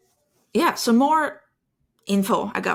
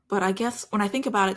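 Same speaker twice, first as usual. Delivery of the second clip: normal talking voice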